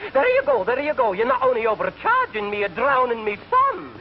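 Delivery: high, wailing Scottish brogue, slowing into a laugh; horrified Scotsman. Acted. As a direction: cry it out as a Scot who lost his bag. Horrified Scotchman punchline.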